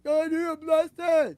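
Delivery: high, loud garbled imitation; acted movie-line shout; mock yell. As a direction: holler the quote upward, hammed-up. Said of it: "he's yelling up to the window".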